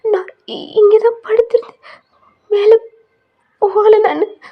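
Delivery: high, loud, slow tearful insisting; crying. Acted. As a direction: plead through sobs, drawing words out. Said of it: "அழுகுறீங்க". (அழுகையுடன் மெதுவாக வலியுறுத்துவது)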